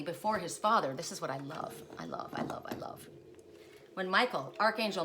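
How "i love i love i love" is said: breathy whispered repeat; soft whisper trailing off. Real, not acted. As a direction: let it fade to a whisper.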